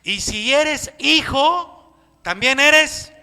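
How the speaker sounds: slow, loud stress on hijo; preaching the point slow and loud; emphatic, drawn out. Acted. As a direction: lean hard on every word. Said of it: "emphatic drawn out".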